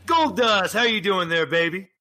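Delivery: big, high-pitched hello; hearty wrestler greeting. Acted. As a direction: greet them like a showy wrestling personality. Game master voices wrestler greeting Asmodeus.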